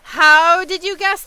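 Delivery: exaggerated New York accent answering chat; mock New York accent. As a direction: belt it like a cartoon New Yorker. Streamer jokingly answers chat question.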